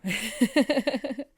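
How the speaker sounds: amused. Real, not acted. Laughs about Virgo.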